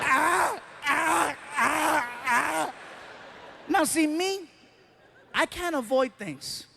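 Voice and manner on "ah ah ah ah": high rhythmic mock laughter; higher imitated heckler laughter. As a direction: imitate the front row laughing.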